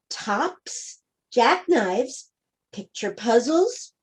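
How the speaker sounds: plain reading voice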